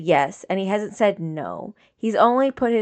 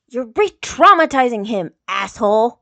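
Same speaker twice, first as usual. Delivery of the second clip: hissed anger; 3 semitones higher, 8 dB louder than usual. A narrator's reading. Katara hissing at her brother over his mistake. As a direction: hiss it at him like a furious sister. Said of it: "she hisses".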